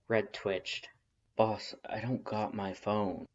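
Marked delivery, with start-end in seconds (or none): 1.4-3.3 slow, lightly gruff Red; lightly voiced rough brother. Red's slow low voice.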